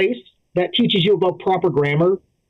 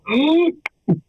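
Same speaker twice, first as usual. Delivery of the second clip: sharp high gasp; shocked gasp; 9 semitones higher than usual. Retold or imitated. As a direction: gasp in horror. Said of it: "my impression of that happening somewhere in Africa".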